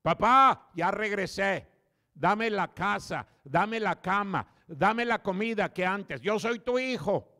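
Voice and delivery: slow down and spell out each demand like a spoiled son; demanding prodigal son. Acted. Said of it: "¿Cómo cree que regresó el hijo pródigo?".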